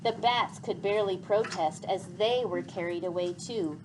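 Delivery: ordinary delivery, nothing marked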